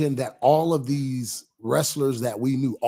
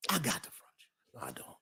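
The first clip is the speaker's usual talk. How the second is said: teasing whisper; 14 dB quieter, faster than usual. Real, not acted. Answering the PW comment in a playful whisper. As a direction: whisper it as a private tease.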